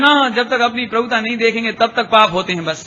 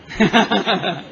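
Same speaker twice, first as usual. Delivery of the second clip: chuckle; 2 semitones lower than usual. Real, not acted. Preacher amused by his own aside, chuckling.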